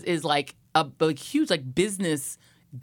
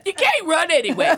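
ordinary talk

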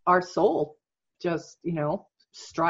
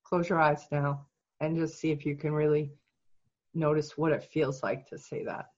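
soft, slightly lowered guiding voice; guided meditation; 8 dB quieter than usual. Real, not acted. Coach guiding client through self-empathy exercise, soft meditation voice. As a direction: guide them gently inward, softly.

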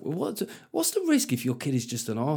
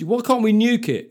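put-on higher, louder eager kid question; naive boy; 9 semitones higher, 6 dB louder than usual.

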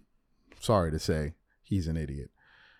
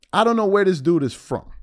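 normal talking voice

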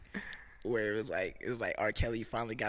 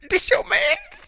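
laughing shout; 11 dB louder, slower than usual. Real, not acted. Shouting the line through laughter.

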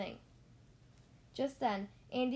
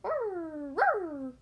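higher, louder revving engine noise; engine growl; 7 semitones higher, 6 dB louder than usual. Acted. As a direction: rev like a guilty toy car. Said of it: "The little car said".

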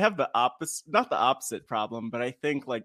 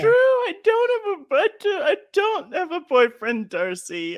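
much higher, louder shrill mock shout; mock shout as Tara; 5 dB louder than usual. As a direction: squeal a hammed-up denial at Darcy. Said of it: "has a look with Darcy that's like".

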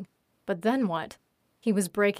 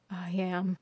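a softer, beaten mutter; 7 dB quieter than usual. A narrator's reading. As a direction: mumble the admission under the breath. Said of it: "muttered Lance".

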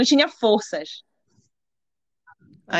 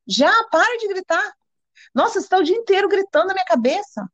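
retold exasperation; 8 semitones higher than usual. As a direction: retell telling someone off, voice raised in pitch. Retold quarrel, fed up. (exasperação recontada, em tom mais agudo)

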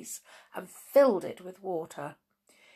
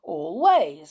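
father bear voice; 8 dB louder than usual. Acted. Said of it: "said the father bear".

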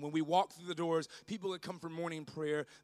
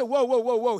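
startled thief; 8 semitones higher, 15 dB louder than usual. Retold or imitated.

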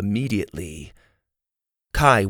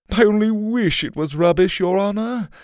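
mock helpless, higher, louder; villain feigning helplessness, higher; fake helpless; 11 semitones higher, 4 dB louder than usual. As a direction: pretend to be powerless, smirking. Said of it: "in a fake helpless tone".

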